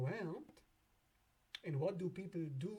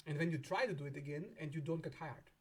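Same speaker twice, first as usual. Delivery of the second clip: ordinary talk, nothing marked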